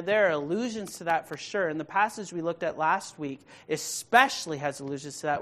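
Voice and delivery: usual speaking voice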